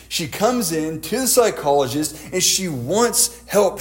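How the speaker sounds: ordinary talk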